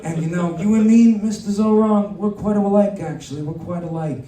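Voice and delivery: a hint of a mob boss lecturing; lightly voiced godfather Trump teaching politics; mock godfather Trump. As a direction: teach the kid like a mafia don. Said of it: "Don Corleone Trump".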